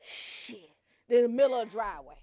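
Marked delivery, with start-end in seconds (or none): 0.0-0.7 breathless whispered curse; winded mock whisper. Heavy guest in the driveway, breathless whisper. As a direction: gasp it out, out of breath. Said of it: "The fat bitch be like".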